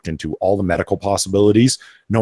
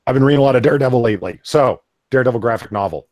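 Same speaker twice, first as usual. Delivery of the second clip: rattled off very fast, a little louder; fast pitch; 5 semitones higher, 5 dB louder, faster than usual. Shown as a demonstration. Host shows off how fast he can pitch, rapid. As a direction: race through it like a speed pitch. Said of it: "Look how fast I can do a pitch".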